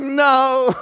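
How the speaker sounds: high, loud, drawn-out comic wail; mock panicked cry. Retelling a scared kid refusing his dad, mock panicked cry. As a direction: imitate a kid hollering in protest. Terrified child refusing in story.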